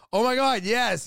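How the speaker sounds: retold burst of emphatic agreement; excited agreement. Retold excited reply to friend.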